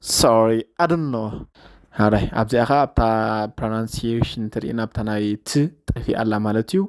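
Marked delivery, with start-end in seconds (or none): 0.0-1.3 slow careful repeat. Shown as a demonstration. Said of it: "repeats clip for learners".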